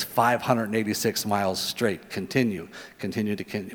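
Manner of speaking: low, hushed navigation prompts; GPS voice. Retold or imitated. GPS directing driver through Kansas, low navigation voice. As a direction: lightly imitate a sat-nav droning on. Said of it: "and it says".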